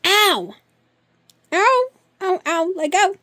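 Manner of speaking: high, loud yelps of pain; real pain. Real, not acted. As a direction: yelp as claws dig in. Cat clawing her.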